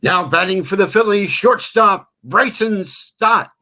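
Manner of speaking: booming stretched ballpark announcement; PA announcer call. Shown as a demonstration. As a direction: call it like the stadium PA, drawing out the name. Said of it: "in front of me".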